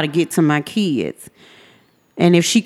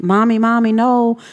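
put-on high, frightened kids begging; a child's pleading voice, pitched high; children pleading; 8 semitones higher, slower than usual. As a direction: voice the kids crying out for their father to stop. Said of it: "I can hear my kids".

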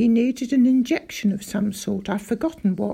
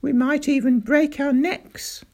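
loud, raised, outraged; indignant rant; 4 semitones higher, 5 dB louder than usual. Acted. Doctor rants to father about diabolical traps, indignant. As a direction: keep the fury going.

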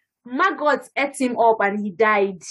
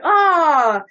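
louder, high mock cry; hammed-up cry of dismay; mock wail; 6 semitones higher, 5 dB louder than usual. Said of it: "you're like".

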